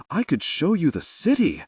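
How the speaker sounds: bright eager pitch, lifted high; keen to impress her; eager offer. Acted. Orso eagerly offers to show city.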